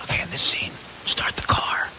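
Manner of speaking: imitating a director's breathy whispered note; retold whisper. Director whispering a note to the actor, imitated whisper. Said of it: "give direction by whispering something in your ear".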